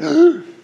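sharp, high, loud gasp; the king walks in and gasps loudly; loud shocked gasp. Acted. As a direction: gasp in outrage. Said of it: "and he's like".

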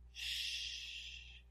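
put-on long, soft shh for a crying baby; soothing shush. Preacher voicing God calming a crying baby, soothing shush. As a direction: shush a baby gently and slowly. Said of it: "like you do with a little baby that's crying".

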